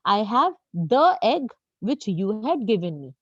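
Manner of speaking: very slow, word-by-word example; slow example read. Shown as a demonstration. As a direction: dictate it to the class. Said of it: "teacher reads example sentence". (धीरे-धीरे वाक्य पढ़ना)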